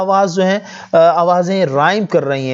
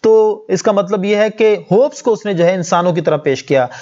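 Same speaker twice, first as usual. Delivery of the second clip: usual speaking voice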